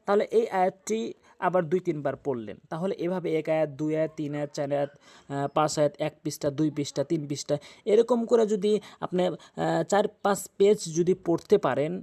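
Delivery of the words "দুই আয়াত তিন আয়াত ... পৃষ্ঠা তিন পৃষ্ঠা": ordinary delivery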